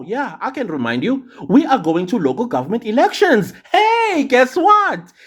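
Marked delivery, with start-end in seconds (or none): 3.7-5.1 high excited hey; excited call-out. Real, not acted. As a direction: call it out, much higher. Calls out to viewers.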